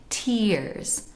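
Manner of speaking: TEARS with a closed ee; modern English vowel. Shown as a demonstration. Teacher inviting viewers to try it, closed-ee TEARS. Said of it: "Try saying it".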